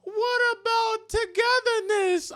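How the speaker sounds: high, whiny pleading mock voice; high mock plea as aliens land; mocking the boys. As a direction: whine for togetherness, hammed up. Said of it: "They're like".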